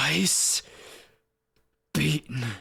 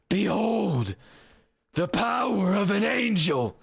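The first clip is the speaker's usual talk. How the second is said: higher, proclaiming edgelord angel; 7 semitones higher than usual. Acted. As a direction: cry it out like a wrathful angel. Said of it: "try to do edgelord voice".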